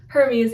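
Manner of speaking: "Hermes" as her-MEHS, tapped r; short first e, long drawn second e in "Hermes"; Greek pronunciation. Shown as a demonstration. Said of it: "first E is a short E".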